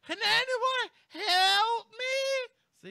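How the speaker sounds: very high, loud, slow, wailing mock cry for help; embellished panic. Shown as a demonstration. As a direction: ham up the trapped woman's panic. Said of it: "that's how you embellish".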